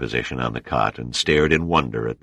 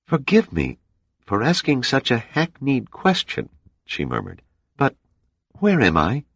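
higher, hushed woman's voice, half awake; dazed woman; 10 semitones higher than usual. Acted. Dana waking on the cot asks the men, dazed. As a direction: voice Dana surfacing from sleep, bewildered.

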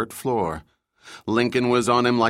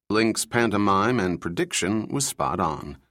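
ordinary delivery

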